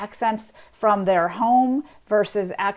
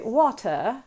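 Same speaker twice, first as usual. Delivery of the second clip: slow, posh British "water" with no R, wah-tuh; upper-class British; 2 semitones higher than usual. Shown as a demonstration. Guest demos a British upper-class "water". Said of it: "If you're British and you're sort of upper class".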